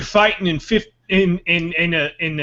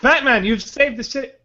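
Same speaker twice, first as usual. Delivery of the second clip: grand thanks derailed by a stench; disgusted commissioner; 5 semitones higher than usual. Pretend. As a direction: play pompous gratitude turning to mock revulsion. Commissioner hit by smell mid-thanks.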